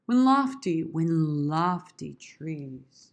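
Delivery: very drawn-out "lofty trees"; slow. Shown as a demonstration. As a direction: linger grandly on LOFTY. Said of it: "I wanna say slow".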